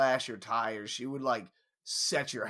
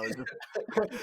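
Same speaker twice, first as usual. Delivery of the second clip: ordinary talk, nothing marked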